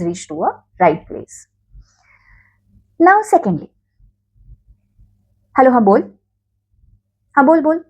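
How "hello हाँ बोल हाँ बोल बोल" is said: offhand, careless phone answer; casual brusque. Shown as a demonstration. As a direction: pick up lazily and casual. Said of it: "this category, you know, of हाँ बोल people".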